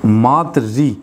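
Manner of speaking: मातृ री split as "maat-ree"; syllable-by-syllable मातृ री, stressing the "ree" sound; pronunciation demo. Shown as a demonstration. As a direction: break मातृ री apart to show the "ree". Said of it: "ये री के रूप में उच्चारण होता है".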